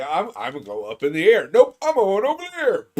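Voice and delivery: fast, then a high loud squeal; voicing the firework. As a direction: give the rocket a silly voice. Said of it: "it's like".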